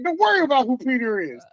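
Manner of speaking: high-pitched comic dismissal; retold brush-off. Acts out his reply to coworkers.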